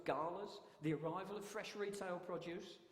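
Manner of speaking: usual speaking voice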